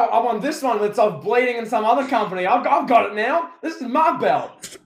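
hammed-up claim, pitched well up; mock wrestler rant. Acted wrestler claiming his belt.

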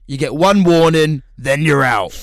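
loud, firm security-guard warning; stern bouncer warning. Retold or imitated. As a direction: lay down the law like a bouncer. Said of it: "the security going like".

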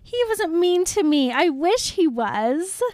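high-pitched, hammed-up Bella protest; acted-out Bella. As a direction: blurt it out high, easing down toward the end. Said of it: "She's like".

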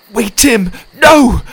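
high, loud cry of alarm; panicked plea. Acted. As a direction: shout desperately to stop a sword blow. Cowering as the sword rises.

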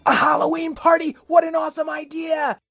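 high, thrilled exclamation; excited. Pretend. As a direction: shout with delight. Excited reaction.

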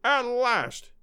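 high, triumphant cry held back in volume; elated high exclamation; drawn-out cry. A narrator's reading. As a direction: savor the triumph, pitched high. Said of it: "he cried".

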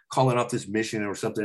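ordinary talk, nothing marked

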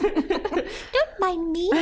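very high, drawn-out little voice; tiny person. Pretend. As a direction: squeak it slowly, playing small and laughing. Joins the tiny-person bit.

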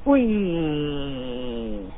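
short high squeaky bat noise; high bat squeaks. Pretend. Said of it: "Give it, like, some bat noises".

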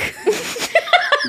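high-pitched giggling burst; real laugh. Real, not acted. Cracks up mid-reading.

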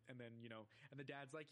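usual speaking voice

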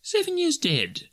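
high, slow Scrooge voice; musing. A narrator's reading. Scrooge puzzling over the ghost's travels, musing high voice. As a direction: ponder it aloud, pitched well up. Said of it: "mused Scrooge".

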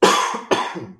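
sharp loud coughs; coughing fit. Real, not acted. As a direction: cough out the water. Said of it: "Water went down the wrong way".

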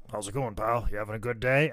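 low, soft boss impression; Vince. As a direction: drop into a low, casual greeting as the boss. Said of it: "he'd be like".

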